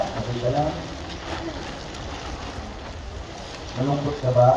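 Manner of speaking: low, soft, mock-caring flirt; sweet-talking suitor. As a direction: coo concern to lure her in. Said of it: "Tapos sasabihin niya".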